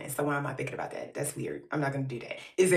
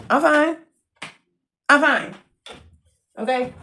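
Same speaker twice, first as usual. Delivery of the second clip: loud, high-pitched protesting; raised, emphatic self-defence; defensive insistence; 7 semitones higher, 11 dB louder than usual. Real, not acted. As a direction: insist forcefully that everything is fine. Defensively proving her home is fine.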